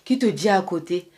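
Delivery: usual speaking voice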